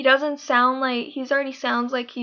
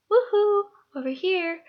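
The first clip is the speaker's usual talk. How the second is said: spontaneous outburst; 6 semitones higher than usual. Acted. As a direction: whoop and call out as a cheeky character. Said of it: "He has really spontaneous scenes".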